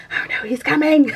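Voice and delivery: ordinary talk, nothing marked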